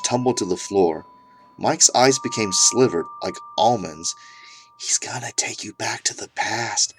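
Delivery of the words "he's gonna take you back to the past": soft, demon-like unvoiced whisper; creepy whisper. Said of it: "he whispers a creepy whisper".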